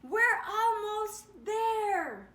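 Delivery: high, loud, slow urgent shout; anxious call. Acted. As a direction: stretch it out to carry over the wind. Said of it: "an anxious voice called".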